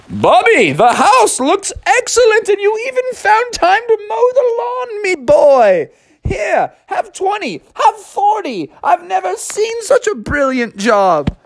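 put-on beam at the babysitter with delight; overjoyed high-pitched dad; gushing father.

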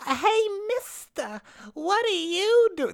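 high, slow put-on flirty voice; imitating the strippers. Mimicking the strippers flirting with the congressman, high put-on voice. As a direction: pitch it way up like a ditzy stripper. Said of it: "This is kind of how they speak".

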